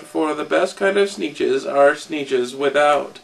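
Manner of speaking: ordinary delivery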